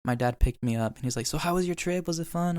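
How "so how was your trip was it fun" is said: cheerful dad voice, pitched higher; imitating dad. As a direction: play the upbeat dad asking about the trip. Dad voiced picking him up.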